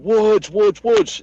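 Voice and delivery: high, loud calling of a name; imitated group yell; retold yelling. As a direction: call out urgently as if across a distance. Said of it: "We're all yelling".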